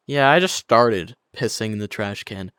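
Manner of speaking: low, deadpan mock admission; imitating matter-of-fact. Streamer imitates friend confessing to the call, matter-of-fact. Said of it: "he was so matter of fact about it".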